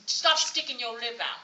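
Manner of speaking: loud, lightly voiced stern daddy; scolding father. Daddy to his pouting little child, loud scolding. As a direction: order it like a parent correcting a child. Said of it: "my daddy used to say".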